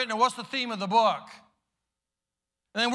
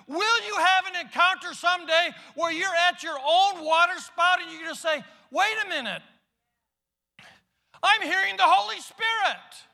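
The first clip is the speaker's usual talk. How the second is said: Holy Spirit calling; 8 semitones higher than usual. Acted. The Spirit calling a believer to wake up, high pleading voice. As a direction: call out to a sleeping listener.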